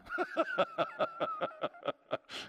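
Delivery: a long high burst of real laughter; high laughing fit. Real, not acted. Preacher cracking up at his own Bible story, high helpless laughter.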